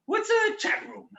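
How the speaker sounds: put-on high, puzzled old-world voice; mock bewildered. Grimm brother asks his partner about chat rooms. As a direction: play dumb about modern tech. Old German playing ignorant.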